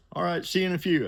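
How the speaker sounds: high boss voice on phone. Retold or imitated. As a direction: pitch it up for the caller.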